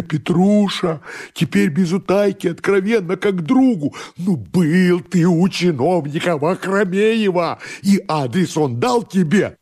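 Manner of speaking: high-pitched, insistent coaxing; desperate pleading master. Acted. Master begs servant for the truth, high desperate pleading. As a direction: plead urgently in a high, drawn-out voice.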